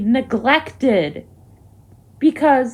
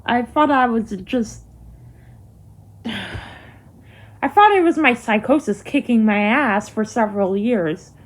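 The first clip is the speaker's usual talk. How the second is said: ordinary talk, nothing marked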